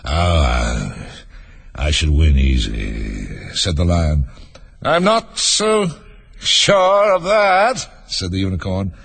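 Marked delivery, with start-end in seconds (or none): 0.0-3.3 low, slow, drowsy lion voice; deep, unhurried storybook lion; boastful lion. A narrator's reading. 4.8-7.8 high, very drawn-out challenge; high-pitched, lingering storybook unicorn; doubting unicorn. A narrator's reading.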